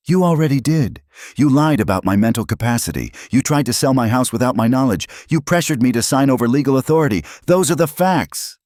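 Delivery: high, loud, fast tirade; angry accusation. A narrator's reading. Father confronting daughter over her lies, angry and loud. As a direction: fire off charges without letting up.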